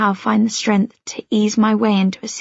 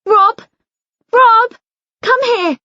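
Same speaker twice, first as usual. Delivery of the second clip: much higher, louder yell for help; 13 semitones higher, 6 dB louder, slower than usual. Acted. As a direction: shout for her husband upstairs. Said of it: "Mum yells".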